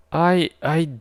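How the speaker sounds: put-on girl accused by Star-Lord; shocked stammer. A narrator's reading. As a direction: stumble over the words. Katz shocked.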